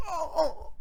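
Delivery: high, soft fake-injury moans; mock pained wail. Soccer player brushed by a gentle breeze, mock pained moaning. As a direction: wail softly as if badly hurt, hammed-up.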